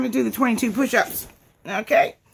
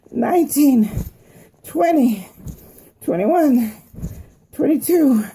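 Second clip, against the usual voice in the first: straining effort; 3 semitones higher, slower than usual. Real, not acted. Straining through push-up count.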